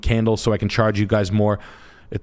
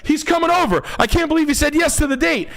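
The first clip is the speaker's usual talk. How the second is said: very high, giddy squeal; mock excited girl. A girl thrilled her date is coming over, very high squeal. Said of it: "and you're like".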